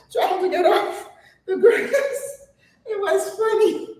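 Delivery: high voice breaking into laughter; laughing. Real, not acted. Retelling the funny photo, laughing in a high voice.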